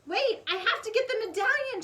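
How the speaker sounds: shout. A narrator's reading. Jack shouts back to Annie, loud and urgent. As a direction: call it out urgently. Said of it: "shouted Jack".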